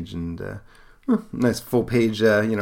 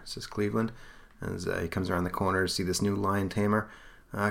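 ordinary talk

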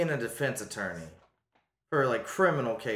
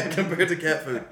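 laughing; 6 semitones higher, 7 dB louder than usual. Real, not acted. Laughing reply.

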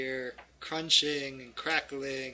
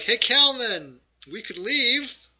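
loud, raised negotiating call; 8 semitones higher, 10 dB louder than usual. Acted. Fighter offering Kalman a way out, calling out. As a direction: call across to the villain.

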